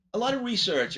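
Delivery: ordinary talk, nothing marked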